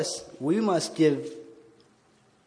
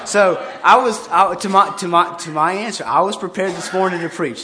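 louder, laughing burst of excitement; excited laughing; 3 semitones higher, 8 dB louder than usual. Real, not acted. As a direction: laugh it out, proud and loud. Laughing about being ready to preach.

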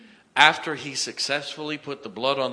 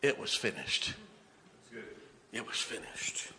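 hushed solemn repetition; 10 dB quieter than usual. Real, not acted. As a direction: let it sink in, dropping away. Solemn echo after the climax.

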